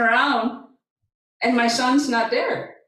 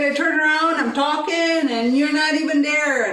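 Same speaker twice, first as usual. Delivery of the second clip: indignant retort; 6 semitones higher than usual. Retold or imitated. Mother arguing back at son.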